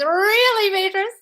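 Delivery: high, loud, drawn-out delight; excited exclamation. Real, not acted. Coach reacting to a viewer's changed situation, excited. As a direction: exclaim it with a big rising swoop.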